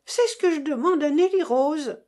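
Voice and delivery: loud, high indignant cry. A narrator's reading. Mother complains about silent daughter, loud high cry. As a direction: shout it across the room. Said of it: "cria madame de Staël".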